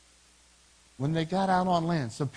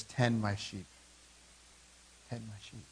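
low, soft, fading on the repeat; gentle charge; 7 semitones lower, 8 dB quieter than usual. Acted. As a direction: entrust it quietly, softer still the second time. Jesus gently charges Peter.

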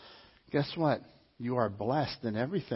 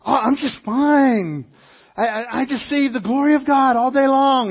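blissed-out believer; 8 semitones higher, 9 dB louder than usual. Retold or imitated. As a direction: gush like someone floating on glory. Detached joyful person quoted.

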